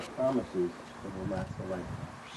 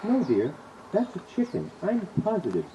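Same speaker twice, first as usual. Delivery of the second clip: asserting wife; 8 semitones higher, 3 dB louder than usual. Acted. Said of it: "she asserted".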